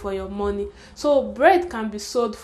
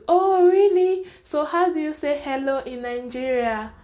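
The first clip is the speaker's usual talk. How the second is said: exaggerated curiosity, pitched up at the start; imitated curious questioner; 5 semitones higher than usual. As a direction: mimic a naive, impressed questioner, easing down after the opening. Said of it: "this same person will ask you".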